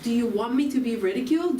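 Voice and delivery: ordinary talk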